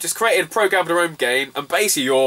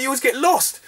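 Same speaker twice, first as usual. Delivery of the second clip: higher teasing voice; mock taxi driver; 11 semitones higher than usual. Taxi driver teasing back, higher mock voice. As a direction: tease like the driver.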